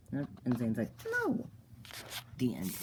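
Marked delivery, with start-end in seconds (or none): 1.1-1.5 sudden very high squeak; a comic high squeak; squeaky refusal. Acted. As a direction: squeak the no out of nowhere. Zane refuses.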